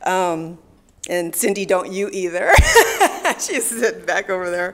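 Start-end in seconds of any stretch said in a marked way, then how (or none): 2.6-3.3 a short, high burst of real laughter; laughing. Real, not acted. Laughing at Cindy sitting in the crowd, real laugh. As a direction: laugh out at the friend in the audience.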